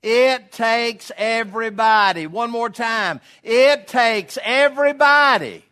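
slow, high, shouted chant with the crowd; loud call and response. Real, not acted. Preacher leading the congregation aloud, shouted chant. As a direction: lead the room in a slow shout. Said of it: "Would you say that with me aloud".

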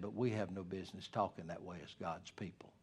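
soft and kind, no self-righteousness; whispered kindly to a brother who cursed. Shown as a demonstration. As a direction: whisper it gently. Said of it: "be kind, don't be self-righteous".

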